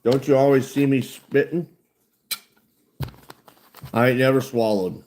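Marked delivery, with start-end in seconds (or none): none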